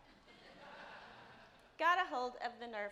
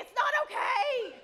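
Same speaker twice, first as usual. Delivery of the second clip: laughing outburst; 10 semitones higher, 10 dB louder than usual. Retold or imitated. Mom overreacting, laughing.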